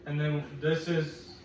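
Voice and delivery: ordinary talk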